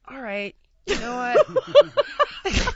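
slow, laughing resignation. Retold or imitated. Teller laughing at the burnt dish, slow and laughing. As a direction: laugh through it, drawn out.

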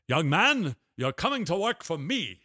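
a hint of a commanding old boss; lightly voiced rich TV boss; imitating the boss. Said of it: "he says in this voice".

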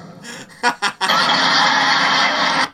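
cracking up at the ad bit; airy howl; wheezing laugh. Real, not acted.

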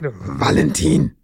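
put-on deep, slow, commanding call of the child's name; firm parent call. Shown as a demonstration. Mother calls her restless son to order, deep and slow. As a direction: call the boy's name like a pack leader. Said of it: "Gott, Hilfe".

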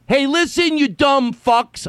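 high sneering mockery, slow on the insult; sarcastic taunt. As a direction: taunt them with scornful sarcasm. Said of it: "I say it sarcastically".

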